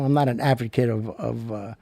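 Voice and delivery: ordinary delivery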